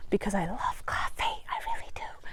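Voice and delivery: whisper. Real, not acted. Playful aside about coffee.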